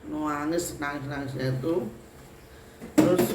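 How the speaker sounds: ordinary delivery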